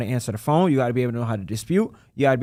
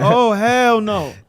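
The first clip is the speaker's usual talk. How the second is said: slow, louder, higher drawn-out hell no; mock refusal; 9 semitones higher, 6 dB louder, much slower than usual. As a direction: caller balking at the accent, dragged out. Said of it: "they're like".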